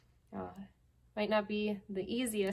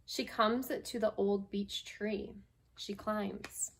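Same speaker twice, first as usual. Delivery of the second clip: gentle, unhurried picture-book reading; lightly voiced storytime read; reading to children. Teacher reading a picture book to her class, storytime voice.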